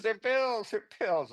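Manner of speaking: playful high-pitched tattle; mock tattling buddy. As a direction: pitch it up like a friend ratting you out. Buddy outs him to the class.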